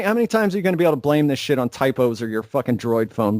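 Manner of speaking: usual speaking voice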